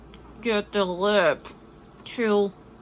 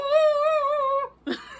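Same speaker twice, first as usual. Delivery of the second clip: high laugh. Real, not acted. Laughing after opera impression.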